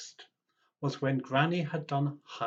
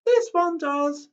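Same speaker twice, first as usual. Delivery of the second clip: much higher, louder, slow and pleased; proud granny; 11 dB louder than usual. Acted. Granny answering Tim's friends, high, loud and proud. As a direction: say it with a grin. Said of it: "smiled Tim's granny".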